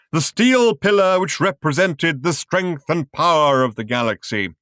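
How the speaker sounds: thundering. A narrator's reading. Showman thunders the pillars' names to the audience. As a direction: thunder it to the crowd. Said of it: "thundered the man".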